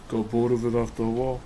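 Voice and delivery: usual speaking voice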